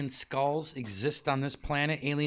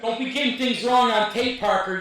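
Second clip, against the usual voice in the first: laughing scold; 8 semitones higher, 6 dB louder than usual. Real, not acted. Repeated while laughing.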